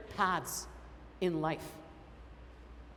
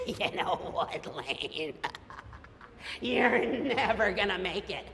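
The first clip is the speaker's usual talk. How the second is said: naysayer voice; 3 semitones lower, 4 dB quieter than usual. Retold or imitated. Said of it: "they're saying to you".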